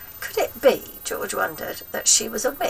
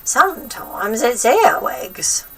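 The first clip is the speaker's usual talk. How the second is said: relishing crone; 5 semitones higher, 7 dB louder, slower than usual. A narrator's reading. Grandma relishing.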